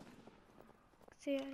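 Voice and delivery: slow, breathy whisper; teasing whisper. Acted. Gamer taunts a hiding enemy in a slow whisper. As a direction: whisper it slowly, taunting the hidden player.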